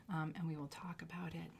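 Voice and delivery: confiding whisper; hushed aside to buyers. Real, not acted. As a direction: whisper it like a private deal. Private sale aside.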